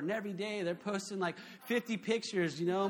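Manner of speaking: normal talking voice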